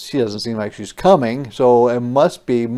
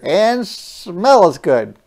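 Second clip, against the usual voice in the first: put-on child's voice; 6 semitones higher, 6 dB louder, slower than usual. Peter praising the cookies.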